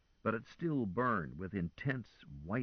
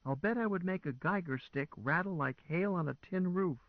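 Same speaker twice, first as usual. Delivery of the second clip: fretful; 10 semitones higher than usual. A narrator's reading.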